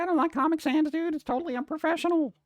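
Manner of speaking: mock the people who hate Comic Sans; mocking snob. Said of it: "People are like".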